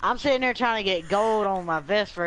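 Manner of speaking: annoyed rant. Real, not acted. Ranting against playing search.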